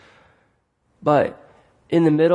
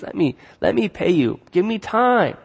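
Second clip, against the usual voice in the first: pleading voice rising higher; desperate servant begging for more time; a mild acted plea, pitched up; 6 semitones higher than usual. A narrator's reading. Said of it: "he begs".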